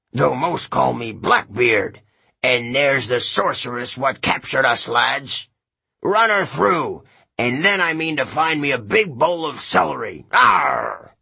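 rally the lads like a bellowing pirate; Blackbeard. A narrator's reading. Blackbeard's voice held.

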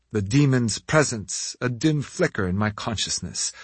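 ordinary delivery, nothing marked

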